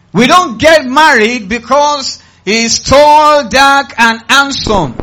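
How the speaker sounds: high, slow, shouted preaching; loud exhortation. Real, not acted. Preacher warning the church on choosing spouses, loud exhortation. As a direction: stretch it out at full volume.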